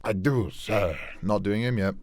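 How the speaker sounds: usual speaking voice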